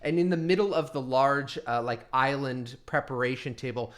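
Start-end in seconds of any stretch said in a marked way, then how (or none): none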